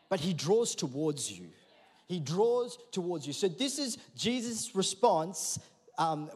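ordinary delivery

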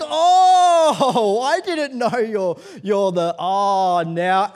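loud, high gush of recognition; hammed-up delighted realization; suddenly happy, nice. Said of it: "now he's all happy and nice".

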